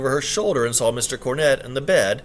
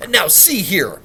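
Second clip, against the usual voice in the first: loud indignant interruption; interrupting protest; 6 semitones higher, 6 dB louder, slower than usual. Acted. Gentleman cutting in on the housekeeper, loud and indignant. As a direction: cut in, indignant. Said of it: "tried to interrupt".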